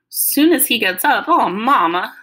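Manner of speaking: loud, high, dismayed echo; Southern daughter protesting in disbelief; upset, disappointed, Southern. A narrator's reading. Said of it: "Mama sounded real upset, disappointed, and Southern".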